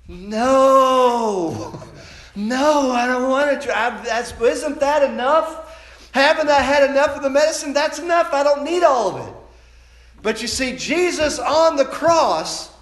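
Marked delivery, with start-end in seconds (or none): 0.1-9.3 loud, high whining protest; child begging Mama, loud high whine; childish wail. Retold or imitated. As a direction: wail like a kid who won't swallow more. Child refusing the rest of medicine.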